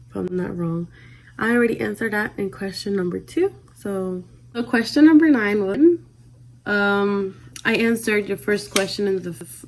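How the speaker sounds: usual speaking voice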